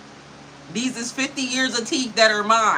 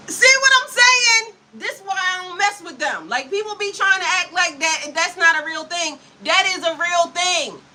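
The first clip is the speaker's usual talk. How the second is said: squealing outrage; 8 semitones higher than usual. Real, not acted. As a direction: go up high in dramatic outrage. Mock-outraged rant about Geminis.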